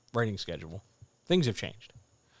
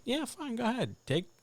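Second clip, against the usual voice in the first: sarcastic; 9 semitones higher than usual. Acted. Sarcastic permission.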